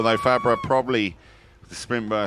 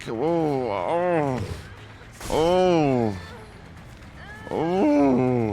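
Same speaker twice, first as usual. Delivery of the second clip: rising excited oh oh; 4 semitones higher, much slower than usual. Real, not acted. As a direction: build the ohs up like mounting tension. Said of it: "I'm making them weird noises".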